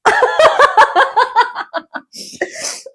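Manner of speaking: squealing laughter; helpless shrieking laugh; high burst of laughter. Real, not acted. Laughing at the vote result.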